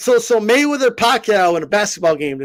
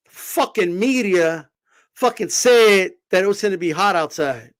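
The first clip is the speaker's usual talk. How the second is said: ordinary talk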